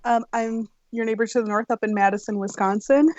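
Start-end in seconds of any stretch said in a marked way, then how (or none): none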